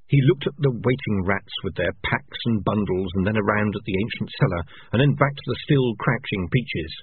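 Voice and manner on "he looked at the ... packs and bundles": plain reading voice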